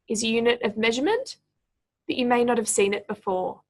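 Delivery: ordinary talk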